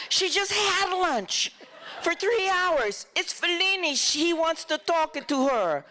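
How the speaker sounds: hammed-up frustrated director, voice up high; exasperated director. Director [name] protesting to the crew, high and exasperated. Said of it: "[name] says".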